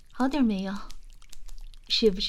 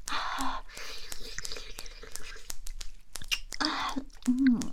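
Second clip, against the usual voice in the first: short breathy moans, higher pitch; small panting moans; moaning; 4 semitones higher, 5 dB quieter, much slower than usual. Acted. (喘息呻吟)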